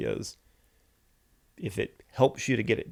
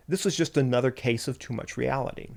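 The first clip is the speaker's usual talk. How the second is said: ordinary talk, nothing marked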